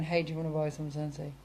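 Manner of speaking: mock flat pitch. Seller imitated asking flatly, quiet and quick. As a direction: rattle it off like a dull pitch.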